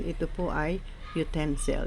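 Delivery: utensil with the second syllable "ten" stressed; teacher modeling utensil, stressing "ten". Shown as a demonstration. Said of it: "stressed on the second syllable".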